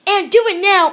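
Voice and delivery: motherly order. A narrator's reading. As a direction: order the child to obey at once. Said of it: "ordered Mama".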